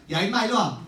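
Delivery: loud bossy talking down; curt older kid ordering. Retold or imitated. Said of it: "he's like".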